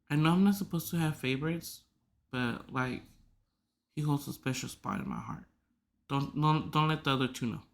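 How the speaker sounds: very soft, low secret admission; hushed confiding. Real, not acted. Pet owner admitting a favorite, soft and confiding. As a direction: drop the voice as if the others might overhear.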